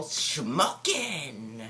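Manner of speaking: swooping, drawn-out cartoonish catchphrase; catchphrase imitation. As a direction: imitate a zany movie character's catchphrase. Imitating the masked character's catchphrase.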